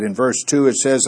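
ordinary talk

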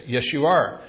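ordinary delivery

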